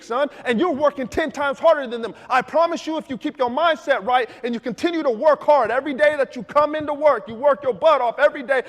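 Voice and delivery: father rallying his discouraged son; fired-up dad. Retold or imitated. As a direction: pump your son up like a coach. Dad's loud pep talk.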